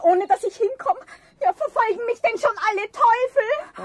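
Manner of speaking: rattled by the haunting; spooked outburst. Acted. Frau Risselmeier cries out to Eder, spooked by the poltergeist.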